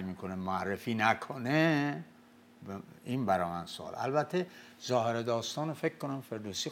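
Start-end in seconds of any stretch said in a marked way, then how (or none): none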